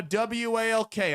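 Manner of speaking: "W A L K" spelled letter by letter. Shown as a demonstration. Dodging the word so his dog won't hear, spelling "W A L K". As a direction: spell out "W A L K" one letter at a time. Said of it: "I'm not gonna say it 'cause my dog's".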